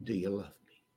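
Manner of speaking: hushed and gentle, nearly spoken under the breath; soft tender question. Acted. Jesus quietly asking Simon if he loves him, hushed. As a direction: ask it softly, the way you would ask someone you love.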